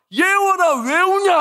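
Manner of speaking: high, loud irritated yell at his daughter. Retold or imitated. Father yelling at crying daughter in the morning, irritated shout. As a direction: snap at her like a fed-up dad. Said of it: "나도 막 짜증이 나가지고".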